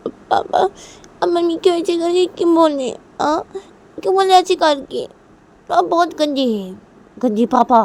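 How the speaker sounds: put-on high, loud child's complaint; upset little daughter. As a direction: play a small girl telling off her father. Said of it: "तो लिया बोलती है". (रूठी बच्ची की ऊँची आवाज़)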